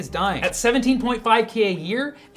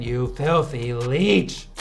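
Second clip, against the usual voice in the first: mock insult; 8 semitones lower, much slower than usual.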